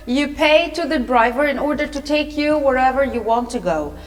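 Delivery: ordinary delivery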